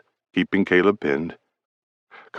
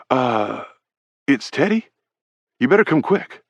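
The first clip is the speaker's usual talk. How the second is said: higher, worried teammate voice; urgent Brady; 6 semitones higher than usual. A narrator's reading. Teammate Brady warns Carlson urgently, higher and worried. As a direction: sound the alarm, nervous. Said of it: "Brady urgent".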